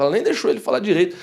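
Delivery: ordinary talk, nothing marked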